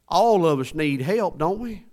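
loud, high rallying call to the congregation; emphatic preaching. Real, not acted. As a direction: punch it out, pitched high and loud. Preacher drives the point home.

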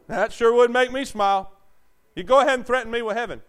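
loud, high, fast taunt; fiery preacher challenge; defiant preaching. Acted. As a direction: dare them from the pulpit. Preaching at full force.

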